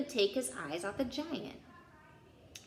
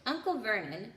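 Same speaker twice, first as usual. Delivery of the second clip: usual speaking voice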